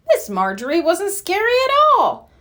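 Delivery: plain reading voice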